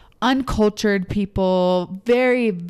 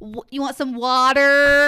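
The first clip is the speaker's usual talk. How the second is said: slow, loud, high mock-American "water"; mocking American accent; 4 semitones higher, 5 dB louder, slower than usual. Brit teasing the American, exaggerated American accent. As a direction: mimic Brits aping an American "water". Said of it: "just their dry humor".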